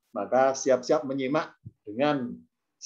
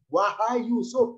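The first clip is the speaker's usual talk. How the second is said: high, slow shouted call; 6 semitones higher, slower than usual. Shown as a demonstration. Al-Aziz shouting for Yusuf, slow high call. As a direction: cry out the name from afar.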